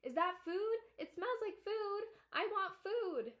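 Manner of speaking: a greedy dog yapping for food; barking dog. A narrator's reading. The dog chasing the children for food, excited.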